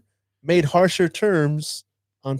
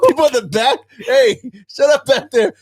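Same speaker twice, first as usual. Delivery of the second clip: much higher, louder playful yelling; mock shout at the crowd; 12 semitones higher, 4 dB louder than usual. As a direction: pretend to hush the back rows. Mock-hushing an imagined crowd.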